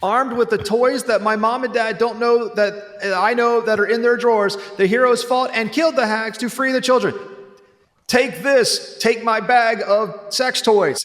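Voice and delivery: put-on high, slow kid proclamation; child narrator voice. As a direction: declaim it like a school-play angel.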